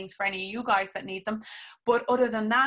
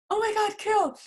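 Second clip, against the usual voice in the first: excited squeal; 13 semitones higher, 6 dB louder than usual. Retold or imitated. Excited reaction to client signing up.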